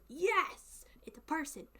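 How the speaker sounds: put-on thrilled Southern accent greeting a kindred speaker; excited Southern accent. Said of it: "my accent goes".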